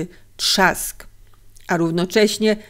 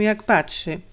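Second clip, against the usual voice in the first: plain reading voice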